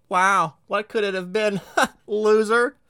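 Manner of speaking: high, loud, slow sneering voice; mocking jeer. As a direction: jeer at himself like a bully. Mocking inner heckler.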